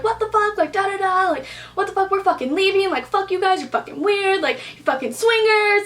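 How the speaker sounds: high-pitched ranting best friend; vulgar outrage. Retold or imitated. Best friend with no filter cursing out the couple, outraged. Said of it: "was like, fucking vulgar".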